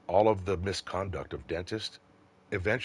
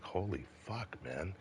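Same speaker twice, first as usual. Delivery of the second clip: much softer, half under the breath; stunned; 11 dB quieter, slower than usual. Retold or imitated. Cop walking away stunned by the crowd, soft and disbelieving. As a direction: murmur it like you can't believe it.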